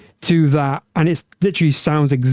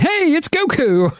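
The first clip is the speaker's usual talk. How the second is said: Goku impression; 12 semitones higher than usual. Game character greeting players in the menus, high cartoon impression. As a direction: call it out high like an upbeat anime hero. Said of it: "That's actually a very good impression".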